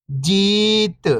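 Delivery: whole word, level then falling at the end; syllables blended. Shown as a demonstration. Teacher showing beginners the joined word, syllables blended. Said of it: "तो मिलके बन जाएगा".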